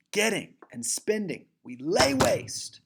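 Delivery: high, hard stress on GET and SPEND, then LAY WASTE drawn out; high emphatic verse with lay waste equally heavy; interrupted trochaic rhythm. Shown as a demonstration. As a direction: punch Getting and spending on first syllables, slow lay waste. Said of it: "with a trochaic substitution".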